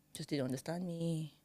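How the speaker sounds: ordinary delivery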